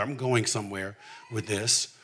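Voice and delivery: ordinary talk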